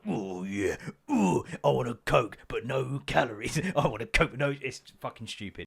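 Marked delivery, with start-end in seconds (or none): 0.1-4.5 a hint of breathy ad women; mock sultry advert voices, lightly done; playful parody of a cola ad; Diet Coke advert women. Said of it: "two women being like".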